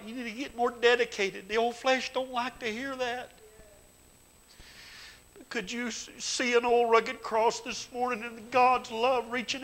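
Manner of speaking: high, loud, pleading preacher's cry; fiery preaching. Acted. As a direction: reach for their souls at full force. Preaching at full force.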